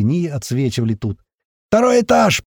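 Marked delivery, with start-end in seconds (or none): 1.7-2.4 high, loud alarm shout; frantic yelling. A narrator's reading. Madman raising the alarm, high loud yell. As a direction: yell it out for the whole building to hear. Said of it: "продолжал орать тот псих".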